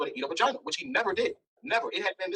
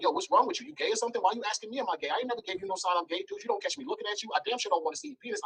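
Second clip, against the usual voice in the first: higher-pitched indignant rant; retold telling-off; 8 semitones higher than usual. Man retelling how he confronted his host, indignant. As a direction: act out going in on him with offended questions. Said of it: "I'm going in on him like".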